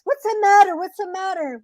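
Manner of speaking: high-pitched mock concern; concerned rabbit.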